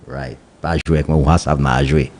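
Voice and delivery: ordinary talk, nothing marked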